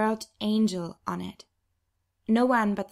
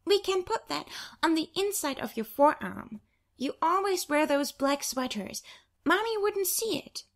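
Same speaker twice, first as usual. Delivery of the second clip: put-on higher, hopeful child pleading; persuading little girl; 8 semitones higher than usual. Little sister persuading Angel, a hopeful childlike voice. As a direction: coax like a sweet little kid.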